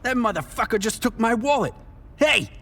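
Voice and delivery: high, angry call building to a yell; furious shout. Acted. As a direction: yell after the thief. Shouting after the pickpocket.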